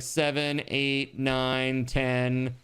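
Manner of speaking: steady monotone count, evenly timed; regular count. Shown as a demonstration. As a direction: count out like a metronome. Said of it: "it's very regular".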